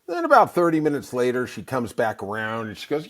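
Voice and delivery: ordinary talk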